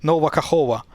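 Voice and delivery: Kahova said with the second K dropped. Retold or imitated. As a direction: Nova Kahova, ending in -ova with no k. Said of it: "drops it and reads it".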